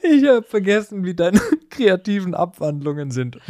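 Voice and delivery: amused giggly remark; laughing. Real, not acted. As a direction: still cracking up while talking. Laughs at colleague's joke.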